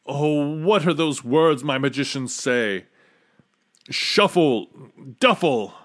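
building from fretful recall to frantic complaint; desperate king. A narrator's reading. King fails to remember magicians' magic words, growing desperate.

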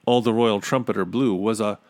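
plain reading voice

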